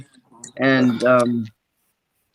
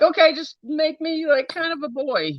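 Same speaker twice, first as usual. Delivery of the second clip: much higher, softer little pleading voice; 13 semitones higher, 4 dB quieter than usual. Pretend. Pretending to beg from the womb, high soft pleading. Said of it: "I'm like".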